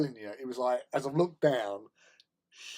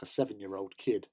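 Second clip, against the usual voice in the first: normal talking voice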